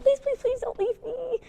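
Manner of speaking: high, soft, slow pleading; clingy plea. Retold or imitated. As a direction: beg him not to leave, mock clingy. Anxious partner clinging.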